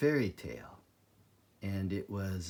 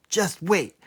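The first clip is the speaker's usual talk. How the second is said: angry threat; 6 semitones higher, 9 dB louder than usual. Acted. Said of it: "he cried".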